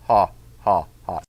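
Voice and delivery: low, mock-evil chuckle; villain laugh. Ehud gloats after the killing with a mock-evil laugh. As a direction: ham up a sinister ha ha ha.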